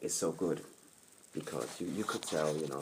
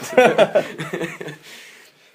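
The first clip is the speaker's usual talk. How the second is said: long loud real laughter; hearty laugh; 10 semitones higher, 16 dB louder than usual. Real, not acted. Telling the interviewer about Nicky, a hearty laugh. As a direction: let a big laugh roll out.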